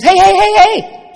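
high, loud hey hey; loud catcall from the fence; calling out. Retold or imitated. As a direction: shout to get her attention. Guy calling out to her.

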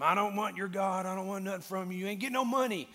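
a cranky loner waving them off; grumpy old man. Retold or imitated. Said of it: "grumpy, just watching the whole time".

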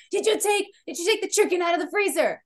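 high, quick mock-mom interrogation; nagging mom. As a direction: fire off the question like an impatient mother. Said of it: "And she's like".

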